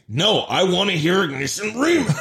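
high, insistent imitation with laughter creeping in; hammed-up demand on the edge of laughing; mock indignant. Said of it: "Just fucking indignant".

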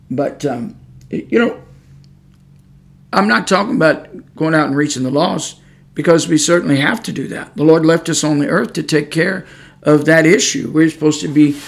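ordinary delivery

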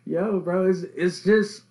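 high, loud, laughing mock voice; laughing at his friend's answer; laughing mockery. Real, not acted. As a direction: cackle while imitating him. Mocking friend's faith reply.

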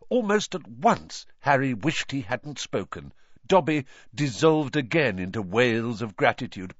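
ordinary delivery

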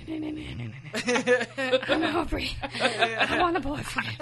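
high, very slow dinosaur voice, laughing. Retold or imitated. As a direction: drag it out like a puppet dinosaur. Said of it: "the dinosaur is like".

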